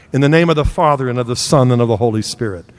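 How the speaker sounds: measured, solemn sign of the cross; opening blessing. Acted. Preacher leading the congregation into prayer, solemn. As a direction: begin the prayer with reverence.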